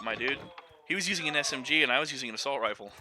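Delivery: normal talking voice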